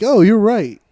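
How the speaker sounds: hammed-up Goku agreeing loudly; mock excited Goku. Goku answering Vegeta in a mock loud voice.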